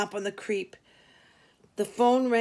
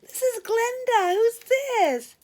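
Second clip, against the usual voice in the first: much higher, louder bright chirp; friendly chirp; 14 semitones higher, 7 dB louder, slower than usual. Acted. Cheery receptionist calling in late, chirping hello. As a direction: chirp it like a bubbly young woman.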